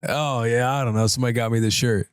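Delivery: low, offhand mumble; imitating the stranger. Co-host mimicking the clueless stranger, low and offhand. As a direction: toss it off like you don't care. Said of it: "that's an incredible voice".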